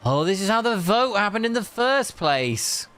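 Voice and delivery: ordinary talk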